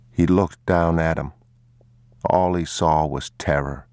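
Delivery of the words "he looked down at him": usual speaking voice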